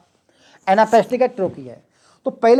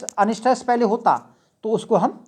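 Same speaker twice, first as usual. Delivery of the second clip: normal talking voice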